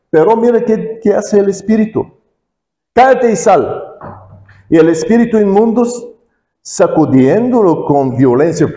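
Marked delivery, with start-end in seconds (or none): 2.9-3.8 commanding rebuke. Retold or imitated. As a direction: order the spirit out with force. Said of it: "Jesús dice".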